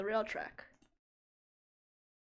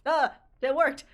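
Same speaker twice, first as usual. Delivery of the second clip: loud, higher cry of success; excited; 5 semitones higher, 12 dB louder than usual. Real, not acted. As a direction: yelp it out, thrilled. Puzzle finally solved.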